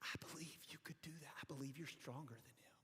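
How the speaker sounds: put-on girl's hushed whisper into dad's ear. Girl whispering to dad at the circus, unvoiced hush. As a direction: breathe it secretly, no voice. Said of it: "the little girl whispered it".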